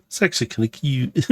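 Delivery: normal talking voice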